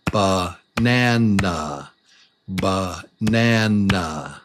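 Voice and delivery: "Banana" stretched slowly, one beat per syllable. Shown as a demonstration. Teacher showing the beats of a word, slow syllable-by-syllable "banana". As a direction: draw out each beat of "banana". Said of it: "a syllable is one beat of sound".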